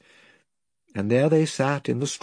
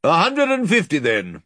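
lord agreeing; 6 semitones higher, 4 dB louder than usual. Acted. Lord closing the deal.

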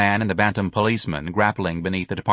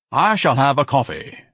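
lofty, higher-pitched pronouncement; grand; 6 semitones higher, 4 dB louder than usual. Acted. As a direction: order grandly, like someone important. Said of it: "Ignatius said grandly".

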